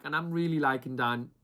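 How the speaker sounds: ordinary talk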